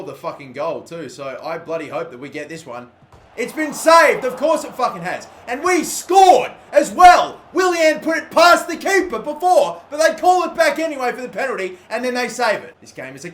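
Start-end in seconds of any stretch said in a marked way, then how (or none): 3.4-12.8 loud high exasperated yell; outraged shout. Real, not acted. Gamer yelling at the screen after a saved penalty, outraged.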